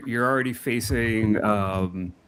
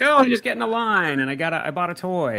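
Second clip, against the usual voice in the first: mocking casual fan; 7 semitones higher, 3 dB louder than usual. Mocking casual convention goers.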